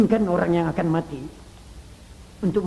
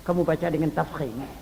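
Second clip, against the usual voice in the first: ordinary delivery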